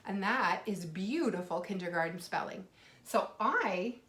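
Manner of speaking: ordinary talk, nothing marked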